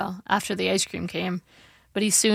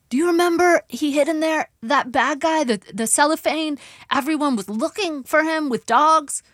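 high-pitched, urgent recollection; excitable confused dad; 8 semitones higher than usual. A narrator's reading. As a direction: voice a father eagerly recalling a muddled story.

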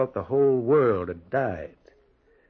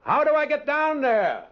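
high, loud call down the slope; shouted question; 3 dB louder than usual. Acted. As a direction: holler it to someone far below. Shouting down to man by tracks.

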